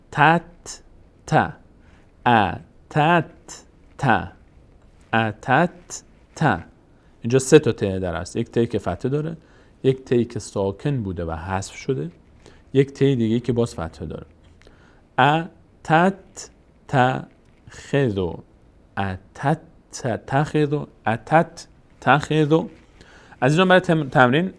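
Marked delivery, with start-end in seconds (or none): 0.1-6.6 "ا تت ت" in separate syllables with pauses. Shown as a demonstration. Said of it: "این ت دو بار باید خوانده بشه". 15.2-22.6 "اتت تخذ" sounded out slowly; syllable-by-syllable. Shown as a demonstration. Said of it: "این ت دو بار باید خوانده بشه".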